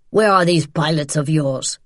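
threatening man demanding an answer; menacing interrogation. Acted. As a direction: press her hard in an altered, forceful voice. Man interrogates pinned woman.

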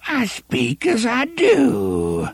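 slow, high, swooping hag voice; dismissive old witch. A narrator's reading. Old witch brushes off girl's rebuke, dismissive. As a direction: wave it away in a crone's whine. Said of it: "The words were dismissive".

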